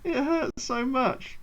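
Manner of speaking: slow, drawn-out moan of defeat; pained lament. Real, not acted. Player who just lost the game, pained moaning. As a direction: moan it slowly, rising into a whimper.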